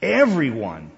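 loud, punched "everyone"; emphatic EVERYONE. Shown as a demonstration. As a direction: hit the first syllable of "everyone" hard. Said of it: "I like the word everyone better".

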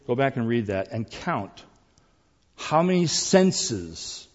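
normal talking voice

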